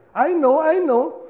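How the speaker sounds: high, loud, slow mocking teen voice; bratty teenager. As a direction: sneer it out like a teen brushing off parents. Mimicking a know-it-all teenager.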